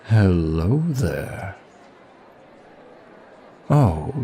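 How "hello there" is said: drawn-out velvet hello; slow seductive greeting. Acted. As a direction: purr it slowly, luring them in. Approaching the stranger to seduce.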